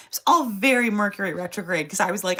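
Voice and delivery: ordinary talk, nothing marked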